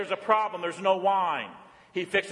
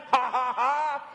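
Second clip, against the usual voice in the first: usual speaking voice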